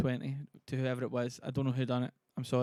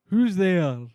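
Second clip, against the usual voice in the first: very loud, raised stage call; theatrical challenge; 4 semitones higher, 12 dB louder than usual. Acted. Streamer as Banquo asks who is coming, calling out loudly. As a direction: call it out boldly.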